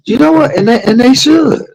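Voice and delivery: playful high-pitched squeal; squealing falsetto. Real, not acted. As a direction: jump up into a falsetto to agree. Squealed aside agreeing with wife.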